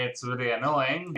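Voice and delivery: ordinary talk, nothing marked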